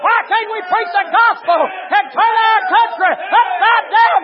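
loud, high, rousing pulpit shout; rally the church with hollered appeals; full-force preaching. Real, not acted.

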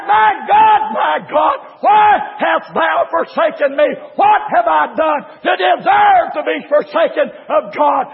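high, loud opening cry, kept driving; preached cry. Retold or imitated. Jesus cries to the Father from the cross, preached cry. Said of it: "looked up into the face of the Father and cried".

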